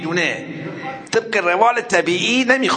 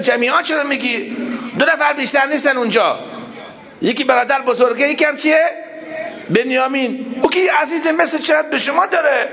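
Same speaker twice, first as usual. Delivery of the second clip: mock shout; 10 semitones higher than usual. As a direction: shout the objection with a laugh. Brothers should have protested loudly. (اعتراض با فریاد و خنده)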